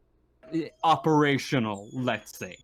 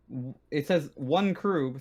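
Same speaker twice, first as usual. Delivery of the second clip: normal talking voice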